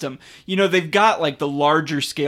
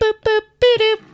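very high cartoon singsong boop; Betty Boop; 6 dB louder than usual. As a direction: coo it like a cartoon flapper. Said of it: "That's my Betty Boop impression".

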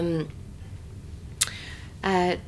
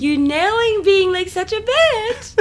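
much higher, loud, teasing; playful self-praise; 12 semitones higher, 6 dB louder than usual. Retold or imitated. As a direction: squeal it like a proud cheer. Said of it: "and I'm talking to myself, and I'm like".

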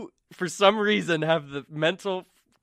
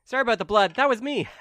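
bright innocent voice; 10 semitones higher, 8 dB louder, faster than usual. Acted. GM voices charmed woman.

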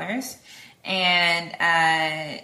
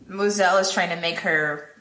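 usual speaking voice

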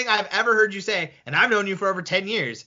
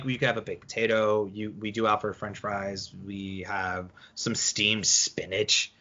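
low, trailing waiter voice, fading softer; a hint of a bored waiter listing options, slowing down; mock waiter; 11 semitones lower, 7 dB quieter than usual. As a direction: lightly play a droning waiter reading the sides. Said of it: "they're like".

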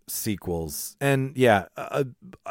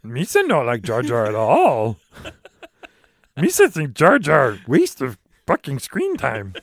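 goofy Gungan voice, higher; Jar Jar voice; 4 semitones higher, 4 dB louder than usual. Retold or imitated. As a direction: do a Jar Jar impression. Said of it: "That's, that's Yoda and Jar Jar".

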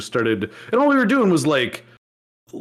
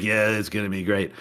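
mock flat enthusiasm; 6 semitones lower, 5 dB quieter than usual. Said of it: "she's like".

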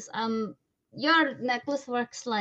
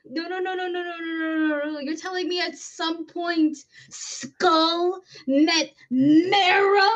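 outraged disbelief; 8 semitones higher, 7 dB louder than usual. Real, not acted. Player catching the game master's bone pun, outraged disbelief. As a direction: build to a loud, incredulous question.

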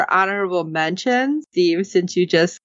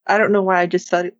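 usual speaking voice

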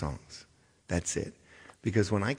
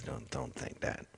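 whispered aside; 13 dB quieter than usual. Real, not acted.

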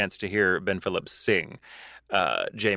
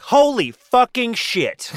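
loud, high, drawn-out exclamation; acted-out astonished swearing; amazed outburst; 9 semitones higher, 6 dB louder, much slower than usual. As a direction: relive someone's stunned breakthrough, punching each word. Retelling student's amazed breakthrough.

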